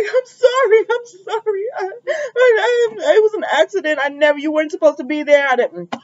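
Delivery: high, tearful pleading; frantic apology. Retold or imitated. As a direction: beg forgiveness in panic. Sheila pleading, caught.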